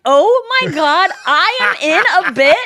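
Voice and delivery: high, slow dawning shock; surprised. Retold or imitated. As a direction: gasp it out, realizing. Realizing she's in his bit.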